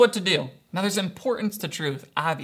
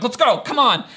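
loud, higher mock impatient push; frustrated urging; 5 semitones higher, 6 dB louder than usual. Dad re-enacting a tricycle lesson with his toddler, frustrated. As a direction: goad loudly, acting out lost patience. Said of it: "I'm, like, getting frustrated".

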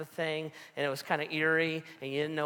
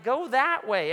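loud, higher curt retort; snapping in anger; 9 semitones higher, 6 dB louder than usual. Retold or imitated. Pastor to fire marshal, angry loud snap. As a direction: bark the direction at him. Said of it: "I was so angry".

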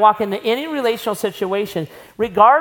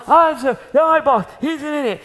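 higher, louder mock grumbling; grumpy husband; 7 semitones higher, 3 dB louder, faster than usual. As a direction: complain like a fed-up husband back from work. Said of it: "If he comes home".

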